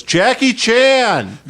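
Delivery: high, drawn-out laughing call; mock heckle. Friend heckles the hack comic with a high drawn-out call. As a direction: yell the name from the crowd. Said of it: "Ryan Shoemaker goes".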